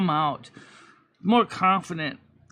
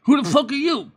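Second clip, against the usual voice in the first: mock angry confrontation; 7 semitones higher, 5 dB louder than usual. Confronting debt collector.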